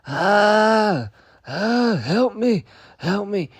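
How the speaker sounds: high, loud screams for help, dragged out slow; panicked screaming. Retold or imitated. Retelling fleeing the hissing snake, panicked screaming. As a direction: shriek like a child fleeing a snake. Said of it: "I was like".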